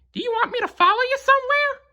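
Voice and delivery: very high, fast cartoon mouse question; Mickey Mouse asking his dog; Mickey's voice. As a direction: rattle it off high and eager. Mickey asks Pluto.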